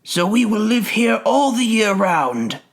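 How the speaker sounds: high-pitched snow and frost spirits; crying out gleefully. A narrator's reading. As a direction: crow it in a high sprite's voice. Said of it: "they cried".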